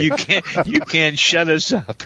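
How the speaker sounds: normal talking voice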